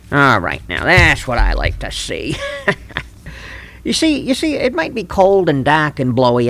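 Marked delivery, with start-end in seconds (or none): 2.4-3.0 high cackle. Acted. Cackle after winning.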